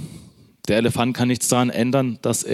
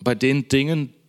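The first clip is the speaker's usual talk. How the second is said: normal talking voice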